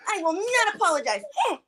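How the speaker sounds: high-pitched imitation of a sulker; mock sulking. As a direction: act out a cornered tantrum, hammed-up. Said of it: "he went to the corner".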